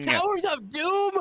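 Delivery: high, slow, incredulous Towers of Doom; mock disbelief. Real, not acted. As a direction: question the map in disbelief. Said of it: "mock disbelief at map".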